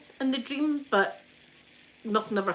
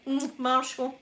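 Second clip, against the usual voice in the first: "Mm, Marshall" murmured quieter and drawn out; softer sleepy moan; 3 semitones higher, slower than usual. Retold or imitated. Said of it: "She must have been like".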